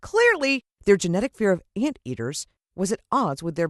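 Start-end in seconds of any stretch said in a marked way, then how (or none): none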